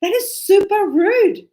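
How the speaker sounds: high, loud, slow what don't say that; shocked teacher. Retold or imitated. Teacher scolds the boy, high loud shock. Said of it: "And I was".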